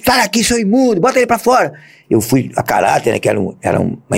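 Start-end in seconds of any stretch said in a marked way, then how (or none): 0.0-1.7 high, scornful yell; shouted expulsion. Retold or imitated. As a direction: throw him out with contempt. (grito de desprezo, agudo)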